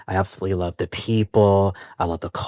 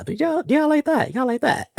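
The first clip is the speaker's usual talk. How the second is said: much higher squeaky teasing voice; playful tease. Real, not acted. Host fishing for praise of his rhyme, playful high tease. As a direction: coax the listeners playfully up high.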